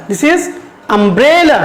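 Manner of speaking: umbrella drawn out with a falling end; slow model reading. Shown as a demonstration. Teacher slowly modelling umbrella for her students. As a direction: stretch every syllable of umbrella clearly. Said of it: "can you pronounce it?".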